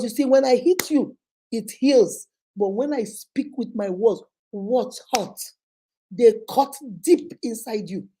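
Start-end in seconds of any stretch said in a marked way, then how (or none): none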